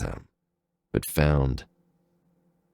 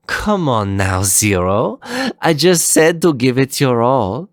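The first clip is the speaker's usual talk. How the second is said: higher, teasing scold; 8 semitones higher, 4 dB louder than usual. Acted. Leviathan scolding Zero to fight harder, teasing. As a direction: chide him like a bored rival. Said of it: "she scolded".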